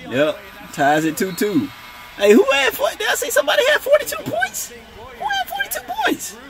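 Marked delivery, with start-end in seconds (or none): 2.2-6.4 very high squealing falsetto; shocked disbelief. Real, not acted. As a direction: squeal the question in utter disbelief. Shocked by a points total.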